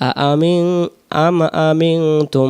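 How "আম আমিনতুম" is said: আম আমিনতুম with the doubled m humming slowly; ghunna demo. Shown as a demonstration. Teacher answers how, modelling the nasal slowly. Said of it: "কেমন করে?".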